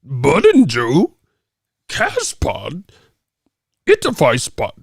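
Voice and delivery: slow, drawn-out silly voice; exaggerated reading of a jumbled message; mock broken English. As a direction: spell out the garble with mock seriousness. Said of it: "you motherfuckers just broken English".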